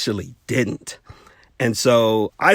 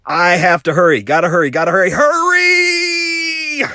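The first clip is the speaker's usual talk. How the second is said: building to a high, shouted hurry; frantic hurry; 5 semitones higher, 4 dB louder than usual. Retold or imitated. Student cramming a test, urging himself on, building to a shout. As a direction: rush yourself, louder each time until you yell. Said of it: "I'm like".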